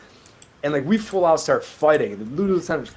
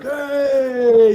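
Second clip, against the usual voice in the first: long, high, loud call; crowd cheer imitated; 12 semitones higher, 8 dB louder than usual. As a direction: imitate a whole crowd hollering at the big reveal. Crowd calling out at the reveal.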